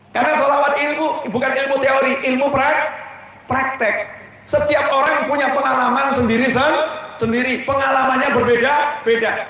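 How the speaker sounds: full-force sermon building to a shout; fiery preaching. Acted. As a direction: drive the point home to the congregation.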